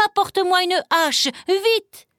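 put-on boy shouting to his mother for an axe; urgent shout. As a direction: yell for the axe, hurry. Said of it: "criait Jacques".

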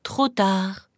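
plain reading voice

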